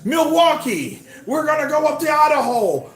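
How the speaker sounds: over-the-top campaign shout, half mocking; mimicking a politician. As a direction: pump it up like a stump speech. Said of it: "he was mimicking that dude, that politician".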